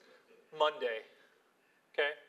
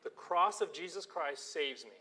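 ordinary talk

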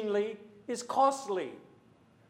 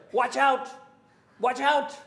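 hammed-up urgent shout, louder and higher; mock alarmed shout; 6 semitones higher, 6 dB louder than usual. A missionary warning his congregation, in a mock alarmed shout. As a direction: bark Watch out! like the French missionary warning the church. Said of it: "he said that through his whole sermon".

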